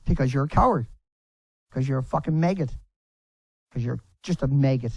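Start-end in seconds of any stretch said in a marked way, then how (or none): none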